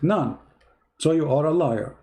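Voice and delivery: ordinary delivery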